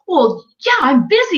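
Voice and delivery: high-pitched imitation of a defensive client; mock indignant excuse. Client's defensive retort retold.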